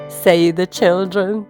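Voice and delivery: ordinary delivery, nothing marked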